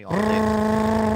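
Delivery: high, loud rapid-fire trill; drill-like trill. Shown as a demonstration. Bragging about old rabbit speed, drill-like trill. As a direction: rattle it out like a drill. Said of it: "He was a drill".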